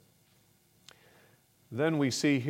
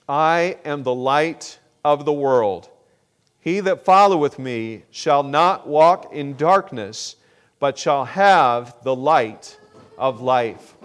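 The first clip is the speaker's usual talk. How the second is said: loud, slow, solemn reading of the verse; scripture reading; 8 dB louder than usual. Acted. Preacher reading Jesus' words to the congregation, slow and loud. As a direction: proclaim each word of the Bible verse.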